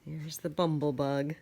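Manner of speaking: ordinary delivery, nothing marked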